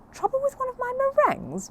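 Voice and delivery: a prim housekeeper taken aback; high, affronted query about her baking; indignant housekeeper. Acted.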